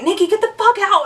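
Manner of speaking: high-pitched retold yell; mock outrage. Caught friend to intruder, mock outrage. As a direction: imitate someone caught and embarrassed.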